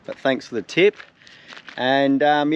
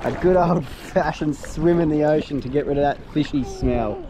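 normal talking voice

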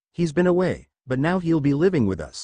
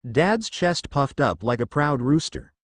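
ordinary delivery

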